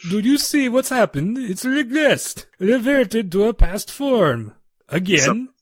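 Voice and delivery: high-pitched, hammed-up Russian accent, slow in places; over-the-top Russian accent. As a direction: play a Russian scientist in a jokey Russian accent.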